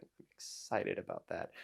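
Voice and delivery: low unvoiced whisper, flat; whispered, ironic excitement; deadpan whisper. Real, not acted. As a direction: mutter the excitement without any energy. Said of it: "I'm really excited about that".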